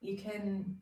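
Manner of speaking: calm, unhurried meditation guide with long pauses; guided meditation. Acted. Teacher leading beginners through a brief body scan, guided meditation voice. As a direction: ease listeners gently down through a body scan.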